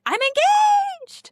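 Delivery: very high, slow, sung-out squeal; squealing excitement. Retold or imitated. Bride telling friends at dinner, excited squeal. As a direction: squeal the news.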